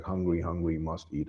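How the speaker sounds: hushed, hungry hiss of a hidden creature; hungry monster voice. Retold or imitated. Retelling the hidden monster in the wall, soft creature hiss. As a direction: breathe it low like something starving in the walls. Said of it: "It's like".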